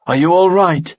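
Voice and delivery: an elf calling to friends after a fight, calling out. A narrator's reading. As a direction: call out from behind. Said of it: "called Kalia's voice from behind".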